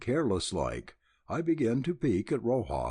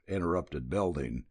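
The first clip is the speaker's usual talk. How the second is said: ordinary delivery, nothing marked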